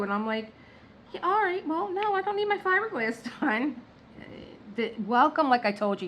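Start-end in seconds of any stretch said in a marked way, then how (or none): 1.1-3.8 exasperated brush-off. Retold or imitated.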